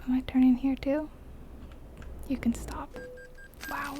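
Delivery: normal talking voice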